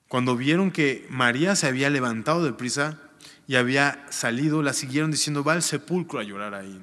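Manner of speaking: formal reading of the Gospel; scripture reading. Acted. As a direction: read the passage out to the congregation. Reads scripture aloud.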